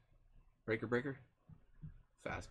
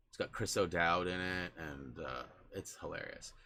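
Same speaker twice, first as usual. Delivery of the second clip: usual speaking voice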